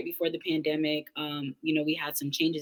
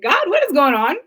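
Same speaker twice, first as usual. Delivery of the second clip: bewildered plea; 8 semitones higher, 7 dB louder than usual. Retold or imitated. Acting out her bewildered prayer.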